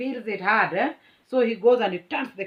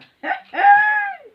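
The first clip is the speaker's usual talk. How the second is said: high shrieking burst of laughter; 11 dB louder than usual. Real, not acted. Telling viewers about the fight, shrieking laugh. Laughing at her own story.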